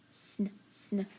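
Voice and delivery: soft isolated "Sn, sn" sounds, no vowel; sounding out sn. Shown as a demonstration. Teacher isolating the sound for students, softly. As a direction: hiss the blend "Sn" for the class. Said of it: "What do we hear in sniffle?".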